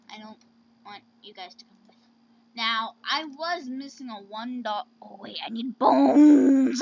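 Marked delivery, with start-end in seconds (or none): 5.4-6.8 booming, drawn-out BONES; loud exclamation. Real, not acted. Player suddenly remembering a need, exclaiming loudly. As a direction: blurt it out loudly, stretching the last word.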